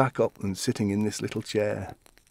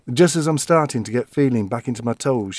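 normal talking voice

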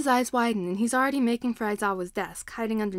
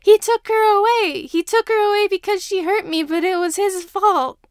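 sobbing scream; 11 semitones higher, 10 dB louder than usual. A narrator's reading. Crying boy screams at teacher about his father. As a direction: howl the blame through sobs.

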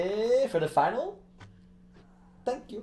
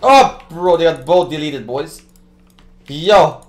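loud stunned hype; excited shout; 13 dB louder than usual. Real, not acted. Streamer hypes to chat as both enemies are deleted, loud. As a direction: shout it out in disbelief.

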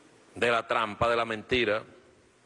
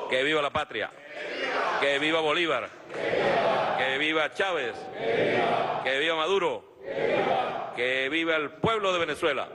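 ranting dictator speech; 4 semitones higher than usual. Real, not acted. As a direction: rally chant of vivas, higher. Rally closing chants.

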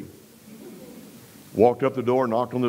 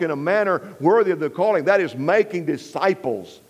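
raised, higher-pitched sermon delivery; emphatic preaching; 5 semitones higher than usual. Real, not acted. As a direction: drive each phrase home to the congregation. Preaches the Great Commission.

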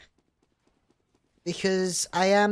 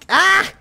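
sudden loud high "ah"; startled yelp; 12 semitones higher, 11 dB louder than usual. Real, not acted. Streamer caught mid-sentence in game, startled yelp. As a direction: cry out as if caught off guard.

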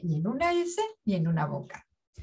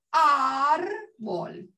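"árbol" with a long, stretched á, then bol; "árbol" split as áaar, bol; stressed first syllable; 4 semitones higher than usual. Shown as a demonstration. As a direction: lean hard on the á of "árbol". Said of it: "con acento en la A".

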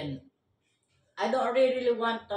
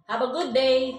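louder, bright goodbye; loud cheerful farewell; 3 semitones higher, 8 dB louder than usual. Real, not acted. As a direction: call it out loudly. Wife calling goodbye to viewers.

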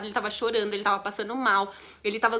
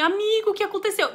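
worried call; 8 semitones higher than usual. Retold or imitated. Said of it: "E eu falava assim".

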